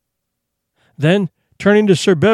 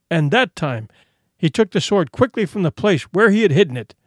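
ordinary delivery

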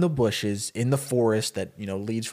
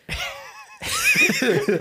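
long high-pitched laughing fit; real high laughter. Real, not acted. Storyteller cracks up at his own dark twist, laughing.

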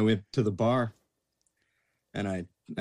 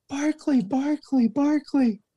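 very high mock-female shriek; imitated screaming fan; 4 dB louder, much slower than usual. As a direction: squeal it like a frantic fan calling out. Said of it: "some lady's screaming".

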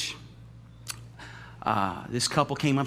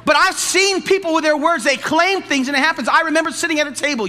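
higher and louder, rising fervor; preaching build; 11 semitones higher, 8 dB louder than usual. Acted. Pastor telling the church of spoken words, fervent preaching. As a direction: drive the point home.

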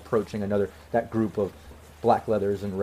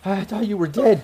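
high, emotional confession; crying in disbelief; 8 semitones higher than usual. Acted. Ex-girlfriend to lost love she believed dead, acted tearful disbelief. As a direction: plead it, overcome by the reunion. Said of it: "And she's just crying".

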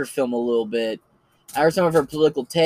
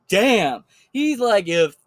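ordinary talk

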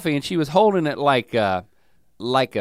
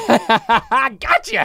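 laughing tease; 8 semitones higher, 4 dB louder, much slower than usual. Real, not acted. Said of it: "teasing after tricking him".

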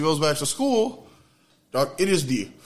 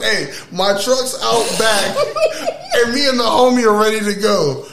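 mock pickup line; 6 semitones higher, 6 dB louder than usual. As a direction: ham up the invitation while laughing. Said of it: "all you had to say was".

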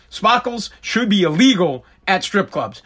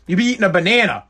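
ordinary delivery

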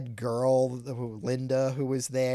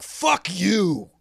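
a hint of an annoyed director, a bit higher and louder; mock gruff retort; 6 semitones higher, 6 dB louder than usual. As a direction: bark it back at the questioner. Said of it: "he'd be like".

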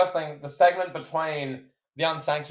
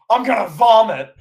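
higher and louder, a commentator's disgusted outburst; Jim Ross impression; 6 semitones higher, 7 dB louder than usual. Retelling a wrestling segment to a co-host, as a loud disgusted Jim Ross. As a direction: do Jim Ross recoiling on air. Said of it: "Jim Ross goes".

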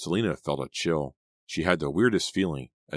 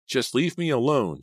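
higher, louder outburst; cried out; 9 semitones higher, 4 dB louder than usual. A narrator's reading. Raised, upset cry. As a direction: Selena pulling away from Danny, crying out. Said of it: "she cried".

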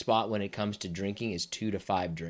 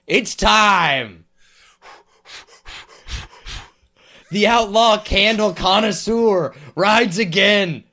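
loud, high, drawn-out show intro; hammed-up announcer; 9 semitones higher, 9 dB louder, slower than usual. Co-host introducing the outlaw candle connoisseur, hammed-up announcer. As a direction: belt out a grand segment announcement.

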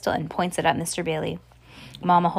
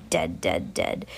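hissed; 12 semitones lower, 3 dB quieter, slower than usual. A narrator's reading. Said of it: "hateful wish in narration".

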